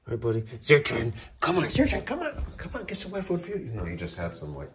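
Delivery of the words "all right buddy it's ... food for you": much higher, calling the cat over; eager high come-on to a cat; pet talk. Real, not acted. Owner calls the cat to eat, high coaxing.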